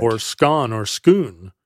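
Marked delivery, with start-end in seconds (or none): 0.2-0.7 Scone said as skon, with a short open O; alternative pronunciation. Shown as a demonstration. Host offering another way to say the abbey's name, Scone as skon. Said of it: "Any vowel, really". 0.8-1.5 Scone said as skoon, with an OO vowel; alternative pronunciation. Shown as a demonstration. Host offering a third way to say the abbey's name, Scone as skoon. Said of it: "Any vowel, really".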